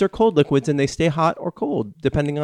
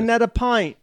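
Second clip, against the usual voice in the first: British pub bloke; 9 semitones higher than usual. Pretend.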